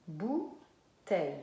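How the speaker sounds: BOUTEILLE slow, BOU lifted, pause, TEILLE; syllable by syllable. Shown as a demonstration. Teacher repeating it for the child, syllable by syllable. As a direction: break BOUTEILLE into two beats. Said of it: "Donc, première syllabe".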